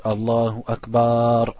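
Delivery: Akbar with the first a drawn out, Akbaar; wrongly lengthened Akbaar; wrong long Akbar. Shown as a demonstration. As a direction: stretch the a of Akbar too long, slowly. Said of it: "une grosse erreur que beaucoup de gens y font".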